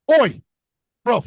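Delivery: mock British taunt. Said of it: "'Cause that sounded like he really meant that shit".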